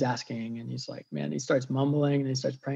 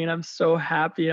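usual speaking voice

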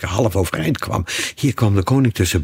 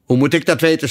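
loud, irritated lion; curt, testy retort; snapped; 9 semitones higher, 5 dB louder, faster than usual. A narrator's reading. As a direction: snap back, pitch raised. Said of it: "snauwde de leeuw".

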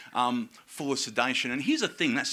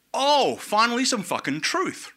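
relieved outburst; 9 semitones higher, slower than usual. Retold or imitated. Relieved at seeing security.

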